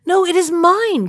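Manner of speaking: high, loud protest; crying out. Acted. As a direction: shout your claim. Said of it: "cried the lame one".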